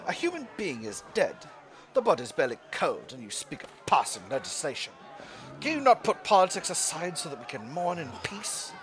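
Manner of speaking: bad British accent, mock outrage at talk of legislation; terrible British accent. Player reading the game's event aloud, terrible British accent. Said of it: "my terrible British accent".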